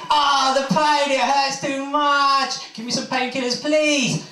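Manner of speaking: high, slow, pained moaning in labour; woman in labour. Acted. Woman in labour begging her partner, high drawn-out pained cries. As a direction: wail through the contractions, dragging it out.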